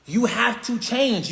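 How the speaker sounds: ordinary talk, nothing marked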